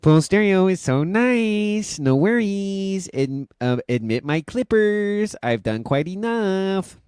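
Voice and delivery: high, slow, silly voice; Chad's text-reading voice. Retold or imitated. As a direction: drag each word out in a goofy high voice. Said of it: "this is the voice".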